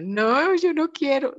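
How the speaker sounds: whiny refusal. Retold or imitated. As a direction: refuse like a reluctant child. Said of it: "Y yo". (ella rechaza a su esposo, protesta aguda y quejumbrosa)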